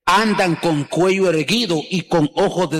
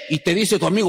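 ordinary talk, nothing marked

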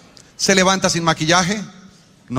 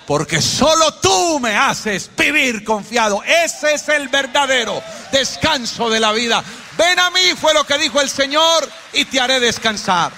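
high, ringing sermon climax; impassioned preaching; 7 semitones higher than usual. Real, not acted. Preacher declaring where true rest is found, impassioned climax. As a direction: proclaim it at full preaching force.

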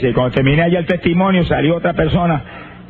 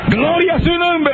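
slow, high, ringing shout of praise; full-voiced preacher's shout; 12 semitones higher, slower than usual. Real, not acted. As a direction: cry it out to the whole congregation. Preacher shouts praise mid-testimony.

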